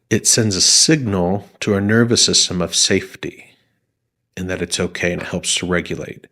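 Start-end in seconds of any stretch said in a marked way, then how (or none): none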